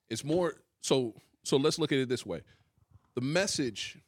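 ordinary talk

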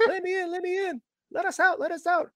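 very high, squeaky mock chant; squeaky kid voice. Short Round squeaking from inside, mock chant. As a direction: imitate the kid's squeaky pleading. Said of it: "repeat of earlier voices".

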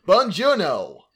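put-on effortless butchered Italian, loud, high American accent; mock an American's no-effort Italian accent; American vowels pushed hard on the Italian greeting; Brad Pitt's American-accented Italian. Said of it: "should at least make an effort".

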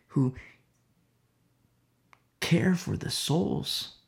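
ordinary talk, nothing marked

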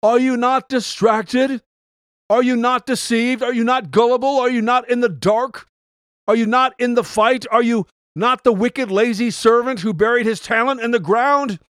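preacher's rising call to the audience; yelling. Shown as a demonstration. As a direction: hammer each question at the listener. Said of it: "I could yell out".

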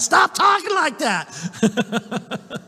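high, comic scolding; playful burst of reprimand; mock chewing out. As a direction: imitate a woman telling someone off. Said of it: "Jeanette will be chewing you out".

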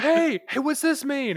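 put-on high, laughing call to a passing kid; mock calling out to kid. Calls out to a kid walking by outside, high laughing mock question. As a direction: pretend to shout out the window, giggling and high. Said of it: "S1: "What is this?".

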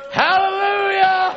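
long, high, full-throated hallelujah; shouted hallelujah. Real, not acted. Preacher shouting praise to the congregation.

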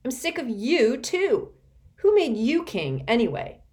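loud, high bitter retort; lashing out with contempt; angry retort. A narrator's reading. Constance attacking Rainey's authority, angry.

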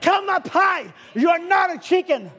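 mother eagle's shrieking call, high; screaming call. Acted. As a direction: call the lost eaglet home. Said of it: "God was screaming".

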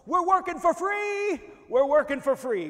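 high, full-force protest yell; screaming at top of lungs. Retold or imitated. Worker screams to everyone at the hog line, top-of-lungs yell. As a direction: scream it out to the whole plant. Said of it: "I would scream at the top of my lungs".

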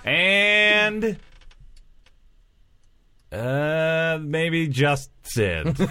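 high, long-held words that trail into an admission; comic drawn-out stalling. Pretend. Performer running out of cast names, comically stalling.

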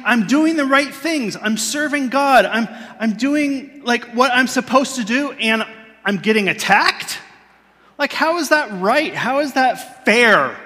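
rising outrage, ending in a drawn-out cry of not fair; a grown man's toddler-like protest; retold angry complaint. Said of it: "I was angry".

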